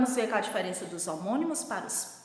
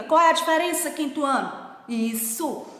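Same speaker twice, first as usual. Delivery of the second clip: ordinary delivery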